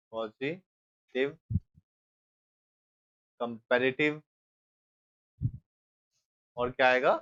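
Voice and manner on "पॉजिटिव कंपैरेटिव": Positive stretched out very slowly while writing; slow dictation. Shown as a demonstration. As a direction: draw out positive syllable by syllable as you write it. Teacher writing the heading. (Positive को बहुत धीरे खींचकर बोलना)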